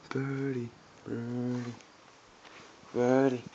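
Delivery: soft coaxing call; coaxing a wild bird. Real, not acted. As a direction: gently lure the bird. Softly calling the bird.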